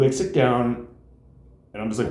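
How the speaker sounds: ordinary talk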